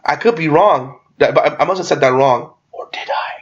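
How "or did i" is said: teasing, whispered question; hushed mock-ominous aside; sly whisper. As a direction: drop to a soft, voiceless whisper to unsettle them. Sly doubt meant to freak someone out.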